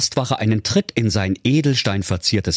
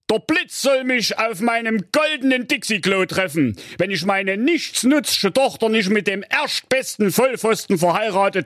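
hohe, pathetische Schwurstimme, zum Schluss langsam; feierlich-wütender Schwur zum Himmel; schwörender König; 13 semitones higher than usual. Acted. König schwört auf Palme. (der König schwört zornig in die Gewitterwolken)

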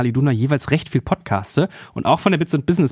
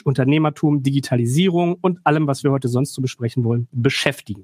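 ordinary talk, nothing marked

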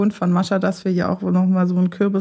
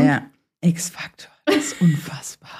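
slow eerie whisper; breathy spooky hush; 3 semitones lower than usual. Acted. Recalling the eerie show intro.

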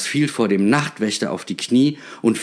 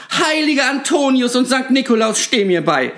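much higher, loud desperate plea to the saints; shouted prayer; 4 dB louder than usual. A narrator's reading. Panicked shopkeeper shouts to the saints for help. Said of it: "schrie sie auf einmal".

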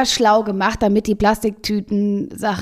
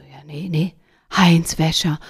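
lower, awed whisper of Heinz Wäscher; hushed whisper; 7 semitones lower than usual. Retold or imitated. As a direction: whisper the name like a secret. Women answer in hushed awe.

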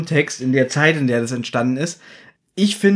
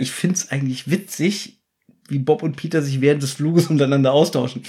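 ordinary talk, nothing marked

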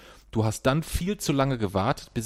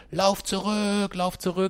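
high, drawn-out mock call; imitated yelling; 9 semitones higher, slower than usual. Parent mimics son ordering his sister back, imitated yelling. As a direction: imitate a child calling out across the pitch. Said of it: "zu deiner Schwester geschrien hast".

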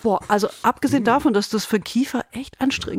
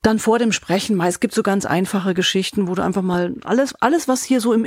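ordinary talk

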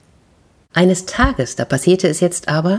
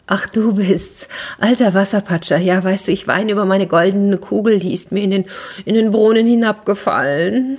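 higher, whiny princess near tears; 4 semitones higher than usual. Acted. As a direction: lament your lost ball like a spoiled princess. Storyteller voices the princess.

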